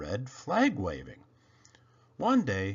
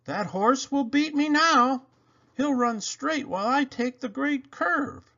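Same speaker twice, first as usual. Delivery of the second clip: high, anxious fretting; little engine afraid of losing the race; worried engine; 14 semitones higher, 4 dB louder than usual. Acted. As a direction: fret about losing. Toodle frets.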